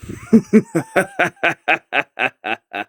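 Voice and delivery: drawn-out high villain cackle, falling at the end; long evil laugh. Acted. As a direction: savor the trick with a long gloating laugh. Villain relishes his disguise.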